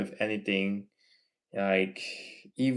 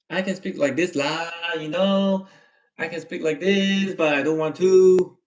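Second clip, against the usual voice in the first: higher, louder Malaysian English with lah; typical Malaysian accent; 6 semitones higher, 7 dB louder than usual. Shown as a demonstration. Streamer shows viewers the accent they avoid, exaggerated Malaysian accent. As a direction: put on a broad Malaysian accent, pitched up. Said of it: "not to speak like a typical Malaysian".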